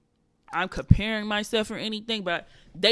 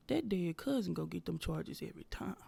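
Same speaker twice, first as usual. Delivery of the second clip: quiet, low-key joke line; hushed aside; 3 semitones lower, 7 dB quieter than usual. Acted. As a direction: say it under your breath, almost whispered.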